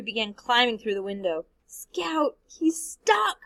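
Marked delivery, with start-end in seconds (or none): 1.7-3.4 breathy, high, half-whispered alarm; breathed. A narrator's reading. Jem gasping to Scout at the fire, breathy and frightened. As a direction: gasp it out in fright. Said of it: "breathed Jem".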